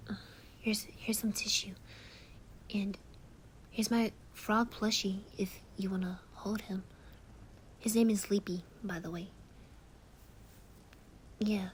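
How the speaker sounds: hushed, tender soothing to a friend in tears; calm them down gently, mostly whispered; comforting whisper. Acted.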